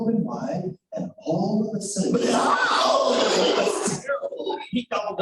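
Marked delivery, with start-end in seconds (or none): 2.2-3.1 shout. Acted. Wolf bursts in.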